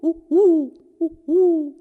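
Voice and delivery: high hooting owl call. A narrator's reading. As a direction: hoot like an owl at night. Owl hoot sound imitated.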